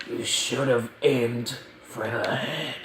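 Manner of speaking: soft, slow put-on villain voice; a kid's slow, quiet try at a menacing villain line; Thanos voice. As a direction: hush it and slow it down like the big bad villain. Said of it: "I'm just not gonna talk like that".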